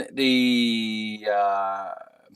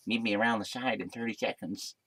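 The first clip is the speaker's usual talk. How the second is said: softer, slurred character voice; put-on bartender voice; 8 dB quieter than usual. Bartender arranging to meet round the side, slurred put-on voice. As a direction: mimic him with slushy consonants. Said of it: "I don't know what he sounded like".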